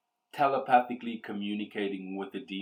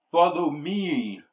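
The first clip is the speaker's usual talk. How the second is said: slow, higher, louder demon command; 7 semitones higher, 8 dB louder than usual. Acted. Freed demon leads the heroes to the castle, slow demon voice. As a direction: beckon them onward, ominous.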